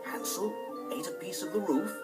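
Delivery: ordinary delivery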